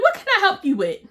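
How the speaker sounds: "What can I help you with?" high and loud, on guard; sharp startled challenge. Retold or imitated. Startled at her car window, she challenges the creepy neighbor loudly. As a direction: snap it at the stranger at the window.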